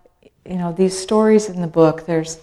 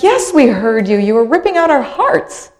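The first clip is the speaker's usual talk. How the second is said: friends answering; 6 semitones higher, 6 dB louder than usual. Retold or imitated. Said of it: "And they're like".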